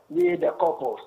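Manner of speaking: usual speaking voice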